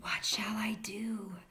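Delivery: soft unvoiced whisper. Rabbit whispering to the squirrel for help, hushed whisper. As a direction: breathe the question out with no voice. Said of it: "he whispered to a squirrel".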